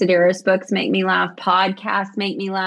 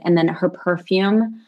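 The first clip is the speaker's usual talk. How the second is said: ordinary delivery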